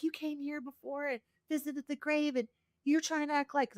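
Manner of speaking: mildly panicked accusation, not shouted; retold freaking-out friend. Scared friend confronting her at the grave, mock freak-out. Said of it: "she was freaking out".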